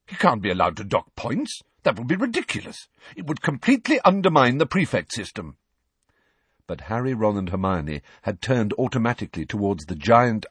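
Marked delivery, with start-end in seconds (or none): 0.1-5.6 rising, quick indignation; appalled prefect. Acted. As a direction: sound scandalised. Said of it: "looking appalled".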